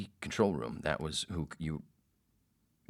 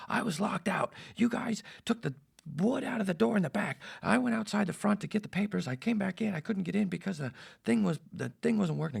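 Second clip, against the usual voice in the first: high-pitched, aggrieved mock rant; screaming complaint; 3 dB louder than usual. Locked-out host explains himself to producer, screaming. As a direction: imitate an irate man's shrieked excuse. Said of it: "screaming at me".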